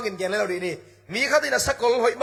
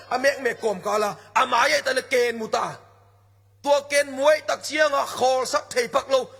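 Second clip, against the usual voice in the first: preaching at full force; 3 semitones higher than usual. Acted. Calls on the congregation.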